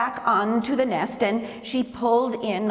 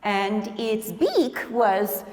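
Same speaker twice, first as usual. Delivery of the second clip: plain reading voice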